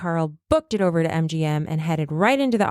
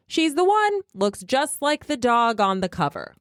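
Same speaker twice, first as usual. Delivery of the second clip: much higher, lifted quoted voice; decisive producer; 7 semitones higher than usual. Acted. Head of production choosing Terry, high and decisive. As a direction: pronounce the casting on the spot. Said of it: "and said".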